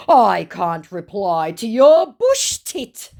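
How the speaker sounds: loud, slow mocking scammer; hammed-up scammer. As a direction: drag it out like a dim scammer. Voicing the scammer.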